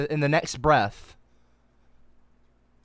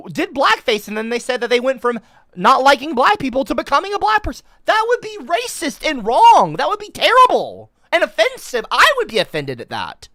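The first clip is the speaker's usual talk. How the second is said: heated indignation; 9 semitones higher, 6 dB louder than usual. Real, not acted. Heated rant about blackface.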